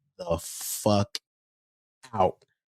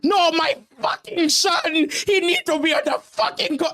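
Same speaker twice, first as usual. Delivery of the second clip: high-pitched, loud screaming impression of a furious father, laughing through it; imitated raging dad; 6 dB louder than usual. Coach imitates the dad screaming at him about benching his son, high furious yell. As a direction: shriek it like an enraged parent losing it at the coach. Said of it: "going nuts".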